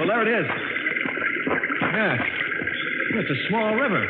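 excited discovery. Acted. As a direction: sound thrilled to spot the river. Finding the underground river.

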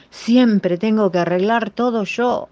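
grumbling. Acted. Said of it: "se quejó el mayor".

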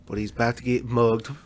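slow, lightly voiced nature-show narrator; a hint of a wildlife narrator, slow; documentary narration. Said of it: "like, narration?".

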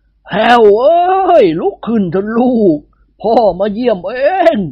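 wailing ghost.

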